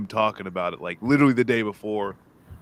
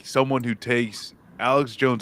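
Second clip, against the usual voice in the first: usual speaking voice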